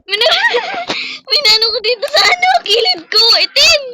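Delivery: high shrieking laugh; laughing scream. Real, not acted.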